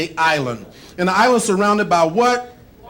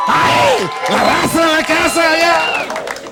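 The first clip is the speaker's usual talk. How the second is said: mock hype; 7 semitones higher, much slower than usual.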